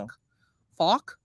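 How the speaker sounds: "Fuck" tried out as "fawk"; tentative Irish "Fuck", like "fawk"; attempted Irish pronunciation. Shown as a demonstration. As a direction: test "Fuck" with a rounded Irish vowel. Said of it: "I forget how they say it".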